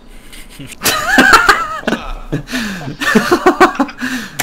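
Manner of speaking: big real laugh, high and loud; loud burst of laughter. Real, not acted. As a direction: crack up at the punchline.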